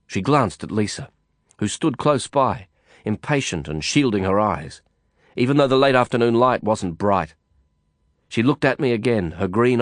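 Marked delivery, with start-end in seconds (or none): none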